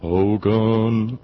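drawn-out comic German officer's call; put-on officer voice, long vowels; Colonel Klink. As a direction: stretch the name out in a mock German accent. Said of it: "That sounds more like Colonel Klink".

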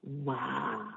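a soft, flat, unimpressed wow; deadpan wow. Retold or imitated. Cab driver reacts to a callous quote, quietly stunned. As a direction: let the wow fall away quietly. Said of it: "And I'm like".

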